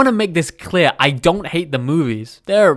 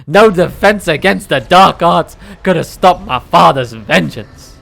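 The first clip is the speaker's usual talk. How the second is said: ordinary talk, nothing marked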